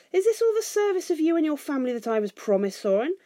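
high-pitched affronted question; indignant protest. A narrator's reading. Bilbo protests the insult.

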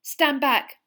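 loud, high warning call. Acted. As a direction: shout a warning through the glass. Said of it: "Hermione called to him".

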